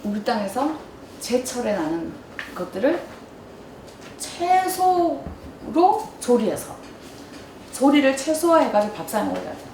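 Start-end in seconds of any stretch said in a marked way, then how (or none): 4.2-5.2 최소로 drawn very slow and high; slow stress. Real, not acted. Lecturer on cooking, stretching 최소로 slow. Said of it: "stressing a key word".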